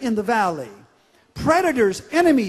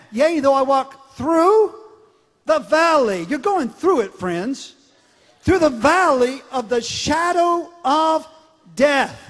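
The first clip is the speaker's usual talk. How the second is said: slow, louder emphatic verse; preaching; 3 semitones higher, 5 dB louder, slower than usual. Acted. Pastor proclaiming the verse to his congregation, emphatic.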